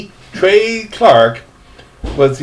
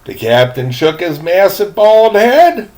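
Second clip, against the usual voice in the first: plain reading voice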